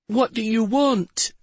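put-on high, slow, tiny girl voice; little girl's tiny voice. A narrator's reading. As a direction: shrink into a scared little girl. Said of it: "in a little girl's tiny voice".

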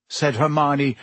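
usual speaking voice

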